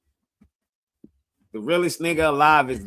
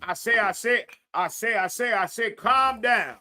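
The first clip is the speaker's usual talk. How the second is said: lightly voiced blustery rooster, higher; Foghorn Leghorn; 8 semitones higher than usual. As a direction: loose Foghorn Leghorn impression. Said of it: "go watch some Fargo and Leghorn".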